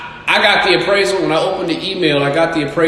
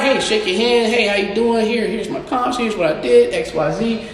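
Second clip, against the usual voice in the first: higher, overly polite meek greeting; meek polite self; 7 semitones higher than usual. Retold or imitated. Himself playing nice to the appraiser, meek and polite. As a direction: act harmless and agreeable at the meeting.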